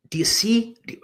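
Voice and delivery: urgent whispered question, pitched high; fired-up whisper. Real, not acted. Coach whispering to his audience, fired up. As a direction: lean in and whisper it with excitement. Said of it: "I just get so fired up about this".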